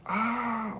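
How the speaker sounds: sudden high yelp of back pain; a sharp cry as the back gives out. Retold or imitated. His back giving way at the car door, a high yelp. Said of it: "My shit starts screaming. It was like".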